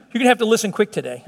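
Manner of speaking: fast, hurried delivery; quick preacher pace; speaking quickly. Real, not acted. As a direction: rush through it to cover ground. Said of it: "I'm speaking up a little quicker".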